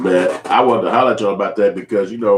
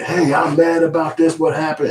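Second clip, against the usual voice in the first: high mocking voice of a grown man complaining; lightly mock him as whiny; mock whiny complaint; 8 semitones higher than usual. Mocking Zoe's complaint to everyone, high mock whine.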